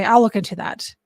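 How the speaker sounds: ordinary talk, nothing marked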